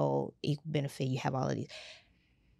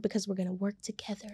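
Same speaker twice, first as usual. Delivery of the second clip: whispering; 3 semitones higher, 6 dB quieter, faster than usual. Real, not acted. Emphatic conspiratorial promise.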